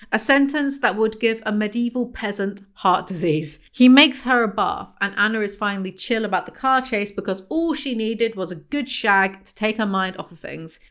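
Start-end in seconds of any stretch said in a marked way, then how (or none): none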